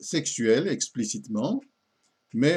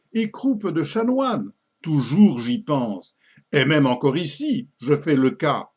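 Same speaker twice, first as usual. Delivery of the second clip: loud, high, swooping ecstatic declamation; rapturous abbot; 4 semitones higher, 5 dB louder than usual. Acted. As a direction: confess it in theatrical rapture, drawing out the verse. Abbot's ecstatic confession in poem.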